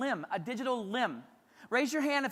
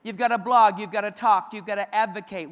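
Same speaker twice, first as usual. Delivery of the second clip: rising rally cry, loud and punchy; loud call to action; 10 dB louder than usual. Real, not acted. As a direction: push each command hard at the crowd. Urging teachers to speak up.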